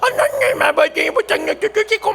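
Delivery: high, slow, giggling parody of the pouting prayer; kids' mocking imitation. Children mock their teacher's prayer, giggling high parody. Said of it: "아이들이 성대모사를 하고 있는 거예요".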